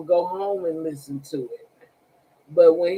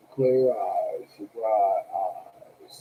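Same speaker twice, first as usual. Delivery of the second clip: flat droning low teacher voice, lower; imitating the monotone teacher who droned all class; 8 semitones lower, 3 dB quieter, much slower than usual. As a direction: drone it out like a bored lecturer. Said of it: "He used to talk like that".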